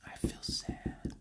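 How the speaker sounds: slow, barely audible whisper; faint inner voice; so quiet. Acted. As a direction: whisper it like a tiny knock. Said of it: "because it's so quiet".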